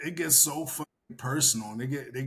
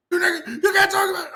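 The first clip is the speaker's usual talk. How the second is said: high, loud, fast whining; mocking upset fan; 14 semitones higher, 6 dB louder, faster than usual. Mocking a fan whining at him. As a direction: rush it like an outraged fan.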